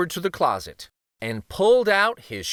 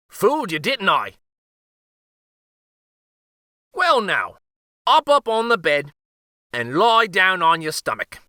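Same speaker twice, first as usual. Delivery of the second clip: put-on high, louder playful old-man voice; teasing uncle; 7 semitones higher, 5 dB louder than usual. A narrator's reading. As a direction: boss the king cheerfully.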